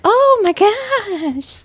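high, very drawn-out squeal; little birds. Retold or imitated. Clue describer voicing the mimed little birds, high squeal. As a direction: squeal slowly like a tiny cartoon bird. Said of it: "little birds are flying around and they're like".

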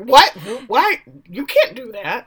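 high, incredulous sputter; mock outraged teacher. Teacher called by first name, mock outrage. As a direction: imitate a teacher scandalized by pupils.

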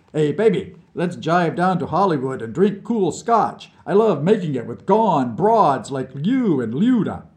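sling the Americanisms like a jazz cat; cocky hipster patter; beatnik slang. A narrator's reading. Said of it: "some garbled Americanisms".

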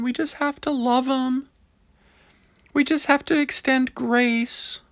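high, soft, syrupy mocking of churchgoers; mock pious voice. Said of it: "always goes to".

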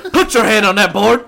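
hammed-up commanding shout; shouted movie command. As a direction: bark it like a film drill instructor. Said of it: "he said".

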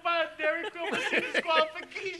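high and fast, laughing through it; after laughing hard. Real, not acted. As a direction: squeeze the words out mid-laugh. Laughing fit during podcast.

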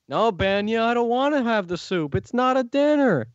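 high, rising sitcom protest; Seinfeld. Retold or imitated. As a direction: whine a refusal in a comedian's voice.

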